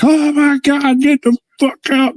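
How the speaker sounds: high-pitched mock scream; retold screaming. Property manager and resident shrieking at an intruder, mock scream. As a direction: shriek it like you've been startled. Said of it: "just start screaming from the".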